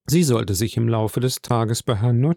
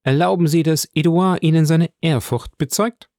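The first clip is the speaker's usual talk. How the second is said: higher-pitched formal lady; a raised, courteous voice for a formal leave-taking; Madame de Villefort, formally polite; 5 semitones higher than usual. Acted. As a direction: address him with ceremonious respect.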